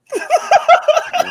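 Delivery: squealing high laugh; high burst of laughter. Real, not acted. Co-host laughs at his own Range Rover punchline, squealing laugh.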